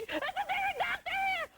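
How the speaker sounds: high, slow, frantic call; retold scream. [name] calling for a doctor in front of the curtain, high and frantic. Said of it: "I'm screaming".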